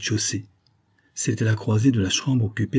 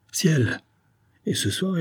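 startled cry; 8 semitones higher, slower than usual. Acted. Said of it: "Léon jeta un cri".

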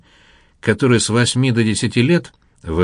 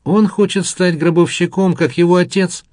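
high, lightly gleeful announcement; sadistic relish; 8 semitones higher than usual. A narrator's reading. Narrator answering for his stunned friend, with sadistic pleasure. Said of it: "испытывая садистское удовольствие".

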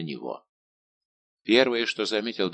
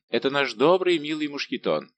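higher and louder, happy recognition; delighted exclamation; 6 semitones higher, 4 dB louder than usual. A narrator's reading. D'Artagnan greets his old friend's servant, exclaiming. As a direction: exclaim it with raised pitch. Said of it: "воскликнул Д'Артаньян".

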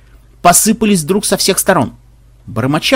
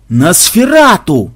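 slow, drawn-out loud cry; horrified crowd-style wail; yelling; 3 semitones higher, 5 dB louder, much slower than usual. A narrator's reading. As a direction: yell the alarm, stretching the word. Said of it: "завопили священники".

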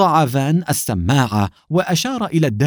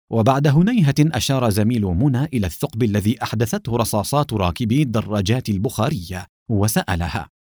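usual speaking voice

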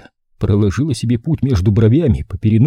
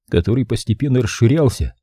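ordinary delivery

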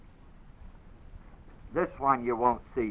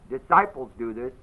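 ordinary delivery

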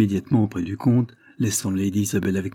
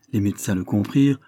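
usual speaking voice

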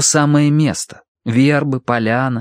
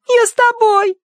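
high whimpering plea; whining child; 4 dB louder than usual. A narrator's reading. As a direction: whine it, about to cry. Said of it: "девочка снова захныкала".

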